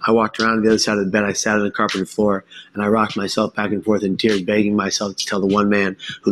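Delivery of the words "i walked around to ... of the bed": ordinary talk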